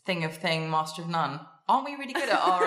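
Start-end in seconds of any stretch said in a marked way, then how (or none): 1.7-2.6 laughing. Real, not acted. Laughs fumbling the phrase.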